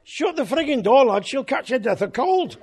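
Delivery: put-on loud, high Scouse accent snapping; a strongman dropping into broad Scouse accent. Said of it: "said in a broad Scouse accent".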